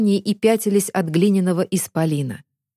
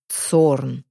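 lower, slow giant voice tasting the word; golem tasting a name; 5 semitones lower than usual. A narrator's reading. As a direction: roll the name around like a giant. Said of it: "Голем пробовал имя на вкус".

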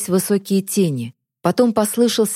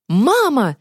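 higher-pitched desperate call; boy's cry; 6 semitones higher than usual. A narrator's reading. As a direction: call after mother in despair. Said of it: "снова крикнул". (отчаянный крик мальчика)

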